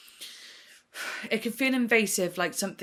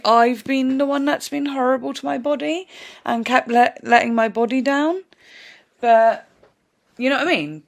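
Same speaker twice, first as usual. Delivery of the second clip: rising, louder and louder; emphatic; 5 semitones higher, 10 dB louder than usual. Real, not acted. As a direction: pour it out with mounting force. Emotional realisation, much louder.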